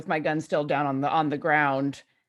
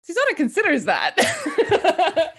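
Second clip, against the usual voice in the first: slow, high, amused narration; 11 semitones higher, 5 dB louder, slower than usual. Real, not acted.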